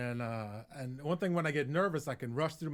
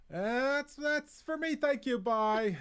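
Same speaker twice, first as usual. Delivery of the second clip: high, loud, hasty farewell; panicked sign-off; 13 semitones higher, 7 dB louder, much slower than usual. Retold or imitated. Comedian fleeing stage with no jokes left, panicked. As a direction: bail out in a squeaky-high voice.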